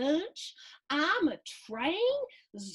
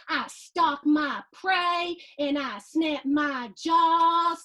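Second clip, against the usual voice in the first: menacing shark; 3 semitones higher, 8 dB louder, slower than usual. Acted. Boy playing shark.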